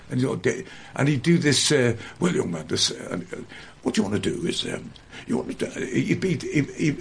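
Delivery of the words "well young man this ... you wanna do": [name] voice. Retold or imitated. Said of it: "I'm not doing an impression, I'm just doing this with the way you do it".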